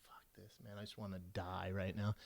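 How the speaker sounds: very low, barely audible grumble; muttered misery. Retold or imitated. Passenger squeezed beside a big stranger, miserable low mutter. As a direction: sink into a hushed private complaint. Silent misery in plane seat.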